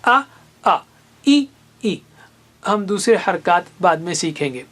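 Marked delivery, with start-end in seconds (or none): 0.0-2.0 آ then ای said short and clear; teacher showing vowel marks; vowel drill. Shown as a demonstration. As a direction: give آ and ای crisp. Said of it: "الف پر زبر کا مطلب آ ہے".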